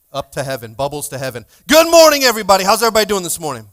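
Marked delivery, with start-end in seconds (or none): 1.7-3.7 loud, high shout to the crowd; rousing greeting. Real, not acted. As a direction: holler good morning to the whole room. Pastor calls out greeting to congregation.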